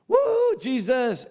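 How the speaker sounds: high, drawn-out worship whoop; excited praise. Acted. Preacher mimics a rejoicing church member, excited praise.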